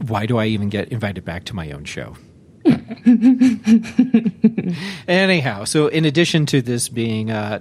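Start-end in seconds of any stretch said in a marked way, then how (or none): 2.6-5.0 hearty laugh. Real, not acted. Host laughs at himself.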